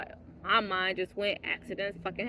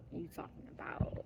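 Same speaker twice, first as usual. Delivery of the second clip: mocking whisper; 12 dB quieter than usual. Mocking his words.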